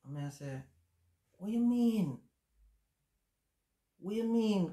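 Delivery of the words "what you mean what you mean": high, louder stunned question; shocked disbelief. Retold or imitated. Told by his brother that Javi is gone, shocked disbelief. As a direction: ask it stunned by terrible news. Hearing his son died.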